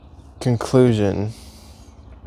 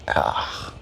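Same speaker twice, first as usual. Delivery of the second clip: ordinary talk, nothing marked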